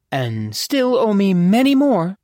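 put-on high, waspish older lady. A narrator's reading. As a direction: cut in waspishly, pitched well up. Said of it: "turning to eye the courtesan waspishly".

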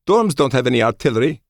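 high, loud commanding officer voice; crisp female officer, firm and high; female colonel voice. A narrator's reading. Colonel Schoen voiced by narrator.

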